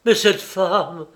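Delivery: loud, high cry of horror; horrified accusation. A narrator's reading. Husband reveals who killed his father, shouting in horror. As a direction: build to a shouted, anguished revelation.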